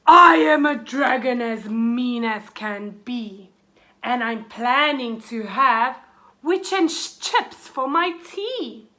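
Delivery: loud, menacing dragon boast. A narrator's reading. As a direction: roar it out like a mean dragon. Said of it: "the witch heard a roar".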